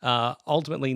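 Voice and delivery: usual speaking voice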